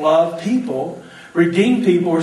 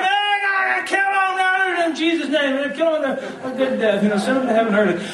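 high, hammed-up stadium yell; mock fan yelling; 6 semitones higher than usual. Preacher mimicking screaming football fans. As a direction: shout it like a rowdy fan in the stands.

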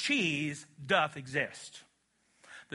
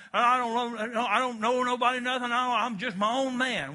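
higher, louder mock know-it-nothing; stubborn loner; 5 semitones higher, 4 dB louder than usual. Preacher mocking a stubborn man, defiant. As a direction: play the man who needs nobody.

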